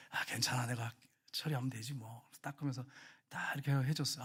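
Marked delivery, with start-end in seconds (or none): 0.1-2.3 gentle and forgiving. Retold or imitated. To the coworker who spilled tea, softly reassuring. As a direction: smile it off, lower and softer. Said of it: "웃으면서".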